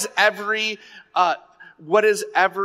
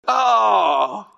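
shocked oh; 5 semitones higher, 3 dB louder than usual. Retold or imitated. Realization exclamation.

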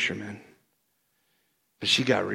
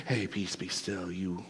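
low, hushed, understated order; soft, low word of authority; calm command; 6 semitones lower, 11 dB quieter than usual. Acted. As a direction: quietly still the storm. Acting Jesus calming the storm.

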